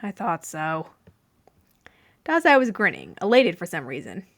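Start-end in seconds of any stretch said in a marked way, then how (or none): 0.0-0.9 sighing. A narrator's reading. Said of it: "Atsushi sighed".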